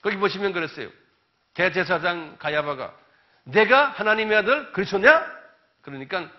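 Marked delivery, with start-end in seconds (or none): 3.4-5.4 loud demanding question; accusing high priest. Retold or imitated. Preacher voicing the high priest, loud and demanding.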